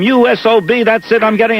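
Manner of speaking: high-pitched imitation of a furious wife; mock hysterical screaming. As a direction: rant like a wronged wife, without real volume. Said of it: "the wife is screaming hysterically".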